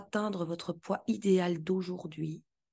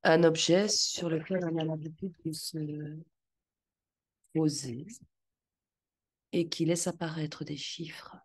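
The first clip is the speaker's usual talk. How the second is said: guided visualization. Acted.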